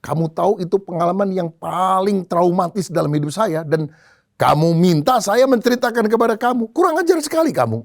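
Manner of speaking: high-pitched outrage building to a quick rebuke; acted angry general. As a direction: play the offended general, pitch climbing as you go. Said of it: "Tiba-tiba wajah dari jenderal ini berubah". (jenderal marah, nada makin meninggi)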